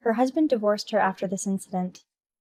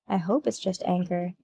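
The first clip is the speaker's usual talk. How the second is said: ordinary delivery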